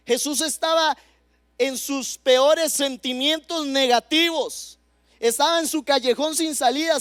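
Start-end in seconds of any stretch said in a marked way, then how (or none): none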